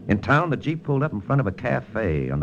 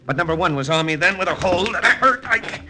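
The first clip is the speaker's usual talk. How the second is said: excited fight narration; 13 semitones higher than usual. A narrator's reading. Detective recounting the alley fight, rising excitement. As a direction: voice climbing higher and higher as the fight heats up.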